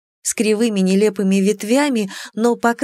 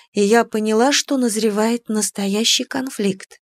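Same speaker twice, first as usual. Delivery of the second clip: plain reading voice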